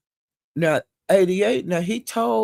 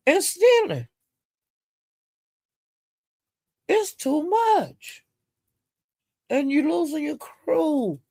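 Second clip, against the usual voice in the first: exasperated; 10 semitones higher, slower than usual. Real, not acted. Exasperated gossip exclaiming.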